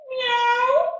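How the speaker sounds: high playful meow; cat meow. Pretend. Teacher meows high during cat pose. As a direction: squeak it like a kitten. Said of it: "That's what a cat says".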